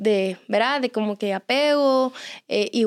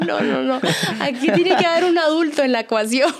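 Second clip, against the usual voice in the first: laughingly insist, voice lifted; laughing protest; 4 semitones higher than usual. Retold or imitated. Mom telling weepy moms someone must be the adult, laughing. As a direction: object through amusement. Joking reply amid shared laughter.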